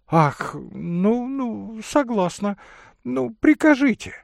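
high-pitched lady giving in; high voice of a fluttery lady; flustered lady. Acted. (высокий дамский голос, уступая)

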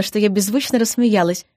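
ordinary delivery, nothing marked

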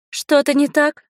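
high-pitched woman's question; voiced young woman. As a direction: ask it in a high female voice. Voices Inga's question to narrator. (высокий женский голос)

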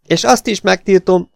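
magasan, hangosan felkiáltva; kiáltás. A narrator's reading. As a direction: tiltakozva kiált fel. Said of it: "kiáltotta Elin". (hangos, magas tiltakozó kiáltás)

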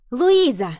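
high, loud cry of the answer; loud shout. A narrator's reading. Pupil calls out the answer in class, shouting loudly. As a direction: shout the name out eagerly. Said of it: "громко закричала Нова".

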